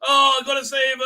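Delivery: high, loud mock cry of alarm; mock heroic cry. Vlogger mocking the heroine's rescue, loud mock cry. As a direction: ham up the panicked rescue cry.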